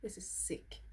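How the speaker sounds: hushed, amazed whisper; stunned, breathy praise; awed whisper. Real, not acted. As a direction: whisper it like you can't believe it. Awe at the expensive palette.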